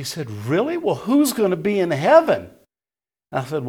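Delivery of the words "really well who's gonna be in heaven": high, loud disbelief as the shocked lady; astonished. Retold or imitated. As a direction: exclaim it in high-pitched shock. Lady astonished that no denomination qualifies.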